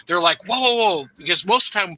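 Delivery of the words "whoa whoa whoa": high, impressed gasp of a collector; amazed whoa. Retold or imitated. Collector eyeing rare coins, higher amazed whoa. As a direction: marvel at the old coins, pitched up.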